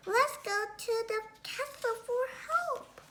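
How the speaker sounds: high, urgent goblin voice; squeaky goblin pleading for help; hurried little goblin. Acted. Pago voiced urging help.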